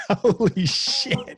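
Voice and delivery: high laughing gasp of disbelief; laughing shock. Real, not acted. Shocked by terror threat story.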